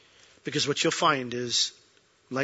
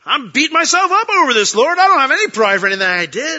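much higher, much louder pleading; indignant protest; 12 semitones higher, 12 dB louder than usual. Retold or imitated. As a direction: protest your innocence in disbelief. Pastor protests to the Lord.

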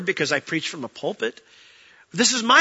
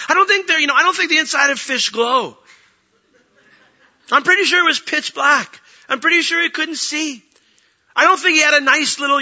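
higher, louder preaching; emphatic preaching; 7 semitones higher, 6 dB louder than usual. Acted. Preacher mocking cartoon Jonah to the congregation, higher and louder. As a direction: drive the point home to the church.

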